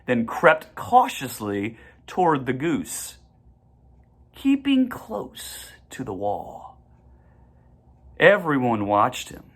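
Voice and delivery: usual speaking voice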